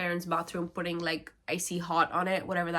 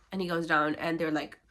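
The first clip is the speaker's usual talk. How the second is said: ordinary talk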